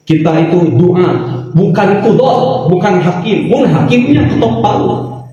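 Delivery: normal talking voice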